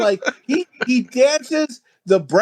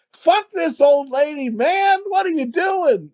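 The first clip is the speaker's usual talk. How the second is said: high, drawn-out mock outrage; stretched-out, incredulous heckling; mock exasperated protest; 7 semitones higher, slower than usual. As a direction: protest in disbelief, pitch climbing well up.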